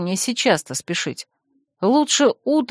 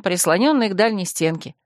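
usual speaking voice

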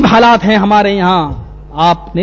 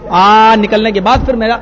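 ordinary talk, nothing marked

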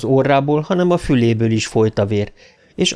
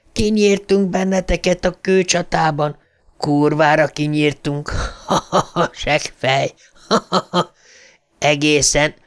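gloating mockery; 4 semitones higher than usual. A narrator's reading. Ghost kids gloating over the rock fight, mocking. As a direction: crow over him, then laugh.

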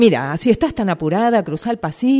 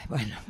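weary sigh; 8 semitones lower, 10 dB quieter than usual. Acted. Boss gives in wearily.

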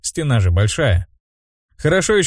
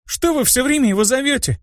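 crying out. A narrator's reading. Little girl confronting the mages, high cry. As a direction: demand it in a high mock shout. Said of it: "крикнула она".

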